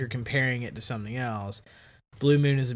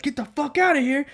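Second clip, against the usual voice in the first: retold shout; 13 semitones higher, 4 dB louder than usual. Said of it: "I'm over there saying".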